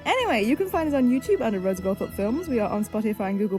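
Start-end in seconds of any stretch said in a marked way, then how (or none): none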